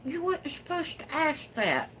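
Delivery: high, coy tease; coy teasing. Real, not acted. Teasing a viewer about new babies, coy and high. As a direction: playfully dodge the question.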